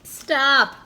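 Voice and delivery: loud high call to stop. Acted. Boy calling to his flying wings, loud and high.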